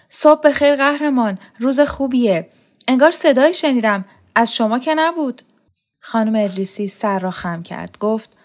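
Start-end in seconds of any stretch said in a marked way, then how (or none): 0.1-5.4 put-on higher, louder, panting call to the old lady; breathless shout. A narrator's reading. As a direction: call out cheerfully between heavy breaths. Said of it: "نفس زنان داد زد".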